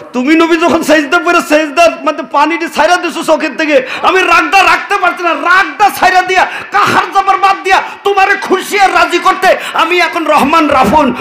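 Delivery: high, impassioned voice of God; full-force preaching. Acted. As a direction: declaim it high and driving.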